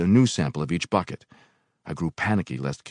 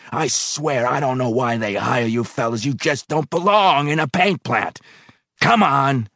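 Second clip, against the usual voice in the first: higher, louder contemptuous boss; scornful boss; 8 semitones higher, 5 dB louder than usual. Acted. Foreman scornfully dismissing the worker. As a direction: sneer at him.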